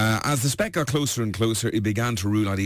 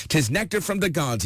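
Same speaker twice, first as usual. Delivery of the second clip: mild shout; 7 semitones higher than usual. Acted. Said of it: "he shouted".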